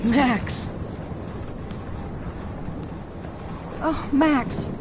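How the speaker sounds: high, relieved cry of a name; relieved calling out. Acted. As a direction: call out with relief. Mother finds Max after tornado.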